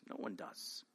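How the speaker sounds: whispered repetition; whispered echo. Real, not acted. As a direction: let it fade to a whisper. Soft repeated echo.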